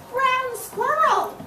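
put-on high, slow old grandmother calling; high grandmother voice calling the boy's name. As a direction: lift way up into a small grandmother voice.

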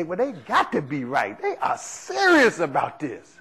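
mock earnest churchgoer. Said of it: "You would think they".

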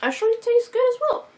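talking around food; slightly muffled chewing speech; mouth full, chewing. Real, not acted. Seen 1: Pursed lips and cheek bulge while speaking; chewing, chocolate unseen.

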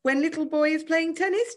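high mock baby-talk taunt; jeering. A narrator's reading. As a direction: jeer as if talking to a toddler. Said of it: "Giles jeered".

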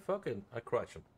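ordinary talk, nothing marked

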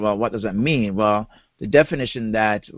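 ordinary talk, nothing marked

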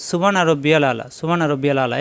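সুবহানা said fast as subana, the h dropped; rushed সুবহানা রব্বিয়াল আলা without the throat sounds; wrong pronunciation. Shown as a demonstration. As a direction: careless everyday reading. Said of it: "এভাবে পড়ি। এভাবে পড়লে হবে না".

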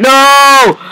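high loud frustrated no; loud anguished no; real shout. Real, not acted. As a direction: yell it in real dismay. Dies again in game.

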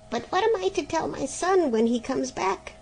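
a fretting mother, voice lifted high; anxious. Acted. Mother fretting to the griffin about her son's return, anxious and high. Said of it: "said the mother anxiously".

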